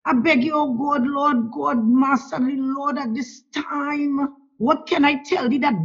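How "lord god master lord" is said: normal talking voice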